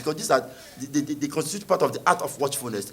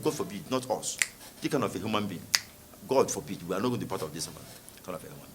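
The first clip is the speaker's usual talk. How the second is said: quieter, lower, recoiling mock protest; mock disciples refusing; 8 semitones lower, 10 dB quieter, faster than usual. Preacher voicing disciples rejecting Jesus, hushed disgust. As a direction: back away in disgust, softly. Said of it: "they said".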